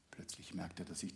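hushed whisper. A narrator's reading. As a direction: breathe it out unvoiced. Sudden discovery, whispered.

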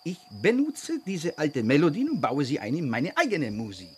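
put-on broken German with Hungarian accent. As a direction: speak like a Hungarian composer.

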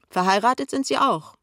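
high, loud insinuation; prying colleague. A narrator's reading. Kessler keeps prying.

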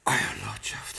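breathless, stunned whisper. Acted. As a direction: barely get the words out. Butler sees presumed-dead lord.

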